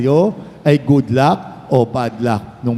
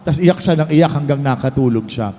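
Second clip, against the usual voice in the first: ordinary talk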